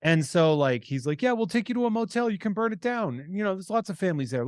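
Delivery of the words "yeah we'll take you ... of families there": high-pitched, rushed imitation of coaxing; mock eager coaxing. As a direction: act out an eager offer, voice pitched way up. Acted coaxing of the boy.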